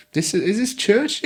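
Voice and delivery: high-pitched, incredulous question; puzzled disbelief. Retold or imitated. Newcomer puzzled by the church building, retold disbelief.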